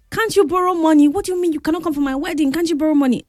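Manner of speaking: high-pitched, indignant pleading; indignant demand. Retold or imitated. Retold bride pushing her friend to borrow money, indignant. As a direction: press the friend hard, voice lifted.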